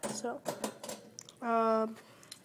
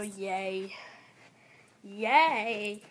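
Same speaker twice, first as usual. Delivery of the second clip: ordinary talk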